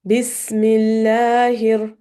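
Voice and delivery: "Bismillahir" drawn out slowly; slow recitation. Shown as a demonstration. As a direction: stretch "Bismillahir" syllable by syllable. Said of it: "we'll read one word at a time".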